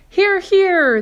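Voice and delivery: very high, loud cheer; cheering shout. Acted. Creatures agreeing with the ladybug, loud high cheer. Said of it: "they all shouted".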